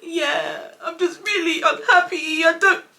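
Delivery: high, loud, wailing fake sobs; mock crying. Daughter admitting to mum she's unhappy, in mock tears. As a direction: blubber it, hammed-up and drawn out. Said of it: "Crying as usual".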